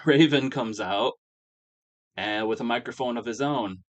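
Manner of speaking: ordinary delivery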